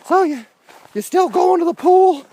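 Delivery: mock cheerful question; chirpy friend. Friend asks, retold.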